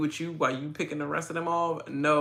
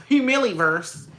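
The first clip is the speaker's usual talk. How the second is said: Humiliverse said higher and louder, scoffing; mocking disbelief; 8 semitones higher, 7 dB louder than usual. Real, not acted. Host scoffs at the twist name, Humiliverse, mocking and louder. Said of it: "That just sound dumb".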